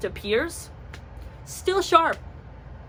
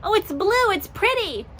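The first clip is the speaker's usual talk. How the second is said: put-on high, girly admiring tone; mock naive delight; higher bright coo; 9 semitones higher than usual. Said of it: "I was like".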